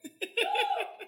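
breathless high laugh. Real, not acted. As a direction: burst out laughing. Laughs after punchline.

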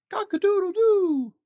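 very high, loud crowing cock-a-doodle-doo; rooster crow. Acted. Dad reading Seuss to a child, loud rooster crow. Sound list.

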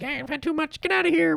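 voicing his fingernail as a tiny high character; mock the nail saying goodbye, up high; squeaky fingernail voice. Playing his bitten-off nail bidding farewell, in a high squeaky voice. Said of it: "He's like".

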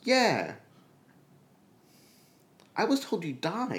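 high, emphatic retold disbelief; acted astonishment. To the friend reported dead, acted astonishment. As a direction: exclaim it high, as if confronted.

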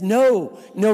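usual speaking voice

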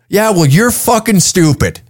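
angry retort. Retold or imitated. Texan answering about the law up there, angry retort. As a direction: imitate an annoyed Texan hitting back. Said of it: "they get angry at you".

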